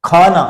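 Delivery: खाना with a breathy kh; aspirated khana. Shown as a demonstration. Teacher demonstrates aspirated खाना. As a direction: start खाना with a puff of air. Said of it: "अब ये देखिए जरा".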